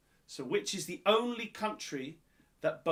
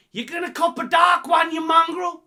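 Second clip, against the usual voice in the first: big mock-Australian bellow, higher and much louder; hammed-up Australian shout; shouted Aussie insult; 12 semitones higher, 12 dB louder than usual. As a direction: yell it like a rowdy Aussie mate hurling abuse. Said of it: "Favourite Australian, uh, phrase".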